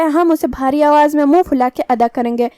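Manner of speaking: usual speaking voice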